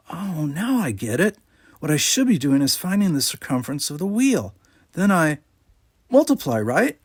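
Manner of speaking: put-on light, lifted young boy's voice; a kid finally getting the math; boyish Jake voice. A narrator's reading. Jake's voice in read story.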